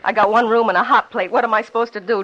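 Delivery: bitter outburst. Acted. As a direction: throw the poverty back at him, stung. Bitter outburst about her poverty.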